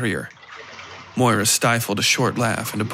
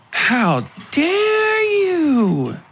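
much higher, stretched-out, hammed-up indignant shout; mock outrage; 3 dB louder, much slower than usual. Moira pretending to be insulted by Vasily, mock outraged shout. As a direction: playfully fake being offended, drawn out and high. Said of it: "she replied in a light voice".

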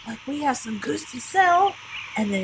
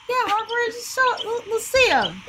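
high eager voice; eager and pitched way up; excited; 12 semitones higher, slower than usual. Acted. Eagerly urging Harper.

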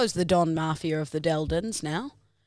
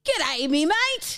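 high, slow shouted Australian greeting; Australian accent; 8 semitones higher, slower than usual. Acted. Asked for Australian, a slow shouted Australian greeting. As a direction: yell it like an Aussie larrikin.

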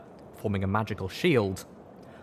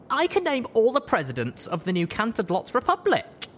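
higher, louder little colt bragging; boasting little colt; 13 semitones higher, 3 dB louder than usual. A narrator's reading. Little colt boasting to the older children. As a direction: show off like a proud little kid. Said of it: "the little one boasted".

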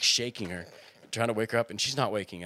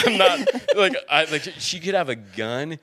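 laughing panic; 6 semitones higher, 7 dB louder than usual. Retold or imitated.

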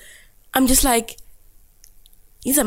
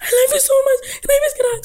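high, gushing fangirl plea, much higher; gushing fans; faster than usual. Retold or imitated. Fans grabbing her, gushing. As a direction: grab her and beg for a hug. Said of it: "people are grabbing me like this".

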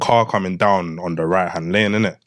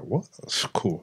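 whispered aside; 2 semitones lower, 9 dB quieter, slower than usual. Retold or imitated. Inner voice retold.

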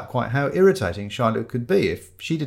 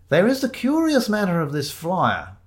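high, ponderous detective pronouncement; insistent sleuth; 8 semitones higher than usual. Acted. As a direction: announce the mystery in a high voice. Shiloh presses his case formally.

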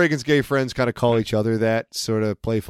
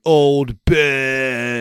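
put-on Boston accent. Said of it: "he's just like".

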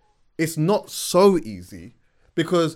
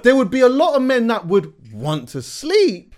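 excited; 8 semitones higher, 6 dB louder than usual. Real, not acted. High excited arguing voice.

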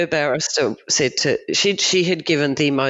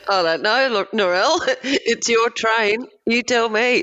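teasing laugh; 9 semitones higher than usual. Real, not acted. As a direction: hand it back with a laugh.

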